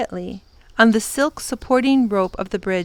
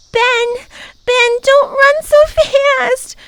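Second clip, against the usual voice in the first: high, slow, fearful calling out; Lindy scared on the bridge, calling to Ben; frightened call; 14 semitones higher, 4 dB louder, slower than usual. Acted. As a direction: beg him to slow down. Lindy calls on swaying bridge.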